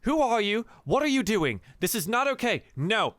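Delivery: high, loud, fast rattled outburst; panicked outrage. Acted. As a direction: lose control, scared and furious. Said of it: "She's like".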